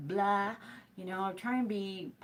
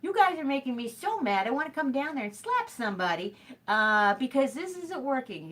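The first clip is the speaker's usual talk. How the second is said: higher and louder, fed-up rant at the store; mock angry rant; 5 semitones higher, 6 dB louder than usual. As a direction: let the anger boil over, half joking. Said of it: "It's like".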